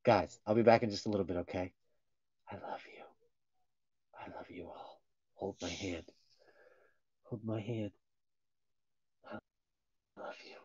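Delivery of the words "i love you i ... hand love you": soft unvoiced whisper. Real, not acted. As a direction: whisper goodbye to the viewers. Whispered goodbye.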